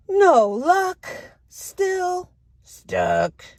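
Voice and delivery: high, odd-voiced lament; stuck duck refrain.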